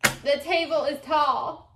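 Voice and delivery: punchy, loud "table is tall". Real, not acted. Repeating after a wrong guess, loudly.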